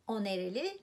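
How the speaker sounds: O nereli with nereli rising at the end; rising question tune. Shown as a demonstration. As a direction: lift the voice on nereli. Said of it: "nereli means where is this person from".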